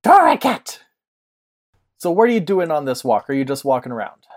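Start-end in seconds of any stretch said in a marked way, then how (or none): 0.0-0.8 cat cry. Acted. GM voicing cat creature.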